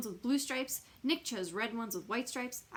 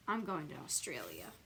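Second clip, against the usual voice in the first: sulky mutter; 8 semitones lower, 8 dB quieter than usual. A narrator's reading. Child declaring escape.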